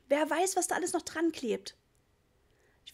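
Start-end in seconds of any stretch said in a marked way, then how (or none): 0.1-1.8 much higher, alarmed shriek; mother shrieking at her boy to drop the dirty bags; hysterical shout. A narrator's reading. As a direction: shriek it in panic. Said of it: "schrie Mama hysterisch".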